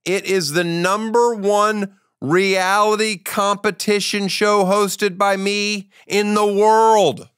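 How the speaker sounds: slow, drawn-out mock-grand promo; announcer boast. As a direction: stretch it out like a hype announcer.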